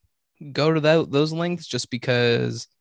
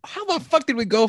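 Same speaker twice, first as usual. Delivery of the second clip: shocked; 12 semitones higher than usual. Real, not acted. Shocked by triathlon news.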